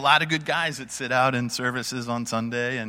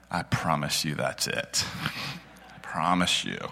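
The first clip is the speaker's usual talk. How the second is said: gentle reassurance; 7 semitones lower, 4 dB quieter than usual. Retold or imitated. Reassuring the shy man.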